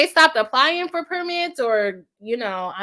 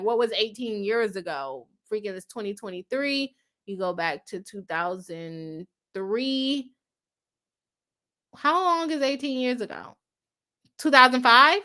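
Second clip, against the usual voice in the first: normal talking voice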